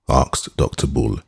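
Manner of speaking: plain reading voice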